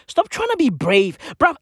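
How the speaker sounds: ordinary talk, nothing marked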